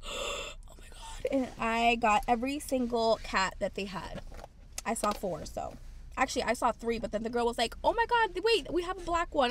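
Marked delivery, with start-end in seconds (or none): 0.0-1.3 gasp, then a hushed whisper; breathless retold amazement; awestruck whisper. Retold awestruck reaction at checkout. 7.8-9.5 high-pitched excited outburst; retold squeal of helpful excitement; excited shop girl.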